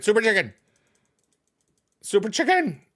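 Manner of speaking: high, excited cry; high-pitched game holler; excited call-out. Real, not acted. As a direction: shout it like spotting the prize bird. Calling out a sighted super chicken.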